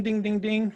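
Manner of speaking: high, light ding-ding-ding; chiming high-pitched dings; imitated dinging. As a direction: mimic an alert bell going off. Imitates an alert going off.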